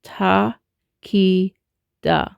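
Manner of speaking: "ta, ki, da" with a gap after each; spaced-out teaching demo; syllable by syllable. Shown as a demonstration. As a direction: demonstrate each syllable separately. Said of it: "we're now gonna say".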